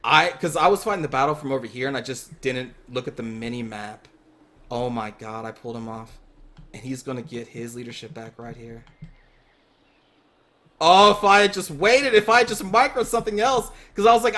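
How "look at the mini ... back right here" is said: much softer, lower, deflated; hushed, crushed commentary; quiet dismay. Real, not acted. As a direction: mutter it in stunned disbelief. Watching his mistake unfold.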